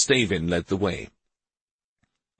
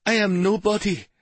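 trembling voice; 12 semitones higher than usual. Acted. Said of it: "answered in a trembling voice".

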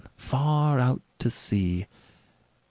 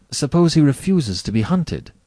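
higher, pressing objection; challenging question; 7 semitones higher, 4 dB louder than usual. Acted. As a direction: press him on it. Rainsford challenges the General.

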